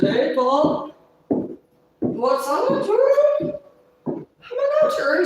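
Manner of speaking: high, loud hollered hello; loud phone greeting. Retold or imitated. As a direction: call out big and overexcited. Acting out her loud drunk phone call.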